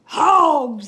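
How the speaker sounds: loud furious bellow; roar. Acted. Rejected cowboy roaring at Fare Thee Well, loud bellow. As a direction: roar it out, stomping mad. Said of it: "he roared".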